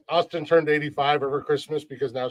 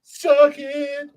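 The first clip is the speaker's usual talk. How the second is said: taunting; 11 semitones higher, 4 dB louder than usual. Real, not acted. Taunting a friend again.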